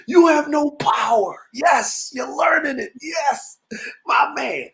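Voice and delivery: hammed-up preacher shout; mock sermon. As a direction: rant like a street preacher about the tax.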